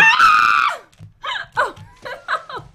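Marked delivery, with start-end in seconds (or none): 0.0-0.8 loud, high startled shriek; startled scream. Real, not acted. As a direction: shriek as if falling. Falling in the game.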